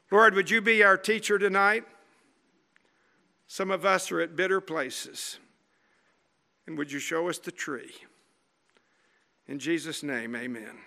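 softening, hushed prayer fading to near silence. Real, not acted. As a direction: pray gently and let it die away. Closing prayer.

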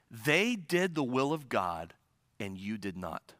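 low, soft and slow, falling to near nothing; hushed solemn pronouncement. Acted. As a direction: lay down the verdict quietly, let it sink. Solemn repeated verdict.

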